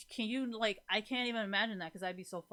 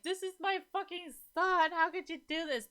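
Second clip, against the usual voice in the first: upset parent; 10 semitones higher, 5 dB louder than usual. Retold or imitated. Parents blaming her over their son, lightly mimicked distraught plea. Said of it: "the parents thing where they're like".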